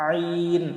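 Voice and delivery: আইন pressed from mid-throat, soft; throaty ain. Shown as a demonstration. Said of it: "এভাবে উচ্চারণ করব".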